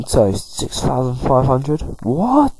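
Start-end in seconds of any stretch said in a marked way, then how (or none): 2.0-2.5 shocked high squeak; surprised. Real, not acted. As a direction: jump way up in disbelief. Reacts to the price.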